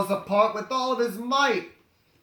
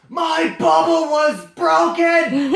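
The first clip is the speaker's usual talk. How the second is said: loud, higher, slow laughing wail; mock outraged shout; 9 semitones higher, 10 dB louder, slower than usual. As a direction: howl it in comic outrage. Gorilla's outraged cry.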